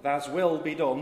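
slow, loud Yorkshire prayer; Yorkshire accent. Acted. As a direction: intone slowly in broad Yorkshire. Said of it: "In a Yorkshire accent".

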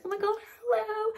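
high, gushing mock-cheerful voice; squealing fake hello, hammed-up. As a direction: parody a fake happy vlogger. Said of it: "being like".

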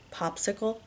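soft, amused echo as a question; quietly amused. A narrator's reading. Husband questions wife's odd safe word, amused. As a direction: query it softly with understated amusement. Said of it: "he says, amused".

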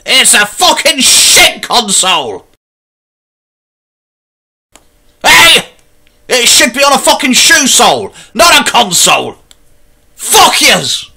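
hammed-up screaming tantrum; furious rant. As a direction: rage at the console like a madman. Rage rant reviewing Xbox One.

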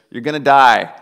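loud, sarcastic "kind" verdict; mock gracious. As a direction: announce doom with fake graciousness. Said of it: "graciously says".

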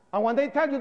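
ordinary delivery

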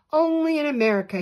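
loud, high, fast proclamation; grand announcement. A narrator's reading. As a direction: announce it with great feeling. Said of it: "he announced with great feeling".

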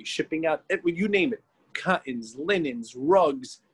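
normal talking voice

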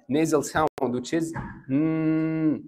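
a long humming mm through the nose; nasal mm. Shown as a demonstration. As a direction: hum mm with closed lips. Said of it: "صوت النون اللي بنسمعه من الأنف". (همهمة مم من الأنف)

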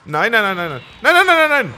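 high, loud frantic string of nos; panicked nos. Real, not acted. Player losing health fast, panicked nos. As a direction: beg the hits to stop. Taking heavy damage.

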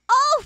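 loud, high exclamation; startled "oh". Real, not acted. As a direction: let out a sudden loud oh. Sudden reaction.

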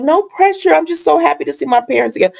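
high, fast, gushing mock daughter; mock-excited grown daughter. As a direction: squeal it out like a giddy girl. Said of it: "she's like".

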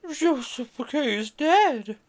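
slow, loud and high, dread in it; the mother telling the girls, horrified; grim announcement. A narrator's reading. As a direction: let the news drop like a stone.

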